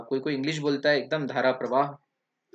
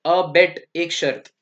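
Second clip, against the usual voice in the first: a bet said slowly and louder, set apart from abate; emphasis on a bet; 2 semitones higher, 6 dB louder, much slower than usual. Shown as a demonstration. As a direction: lean hard on a bet, slowly. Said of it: "ये दूसरा word है".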